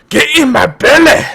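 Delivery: high, loud hammed-up Scottish roar; a shouted mock Scottish accent. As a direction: bellow it in a comic Scottish accent. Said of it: "the only time I get to do the Scottish accent".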